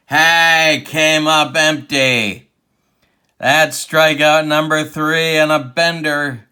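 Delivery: big, ringing baseball announcer call; excited strikeout call. Pretend. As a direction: punch out the strikeout like a sportscaster. Calling a strikeout live.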